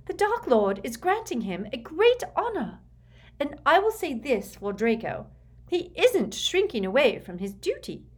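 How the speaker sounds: high, loud, emphatic zealot; proud, fanatical Bellatrix. Acted. Bellatrix lecturing her sister on Draco's honor, proud and forceful. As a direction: declare it proudly, pitched well up.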